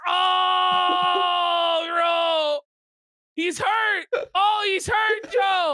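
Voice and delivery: high, drawn-out hammy wail; wailing commiseration. Real, not acted. Streamer watching Joe take the hit, high wailing commiseration. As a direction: wail in delighted sympathy. Reacting to Joe being wounded.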